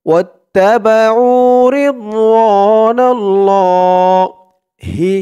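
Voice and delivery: রিদওয়ানাল্লাহ with the la stretched three counts, slow; three-count lengthening. Shown as a demonstration. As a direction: draw out the lah of রিদওয়ানাল্লাহ long before the stop. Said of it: "তিন আলিফ টান হয়ে গেছে".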